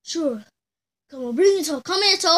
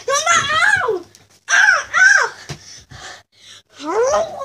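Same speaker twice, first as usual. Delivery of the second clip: pretend pain cries; 11 semitones higher, 5 dB louder, much slower than usual. Dad hits boy in play.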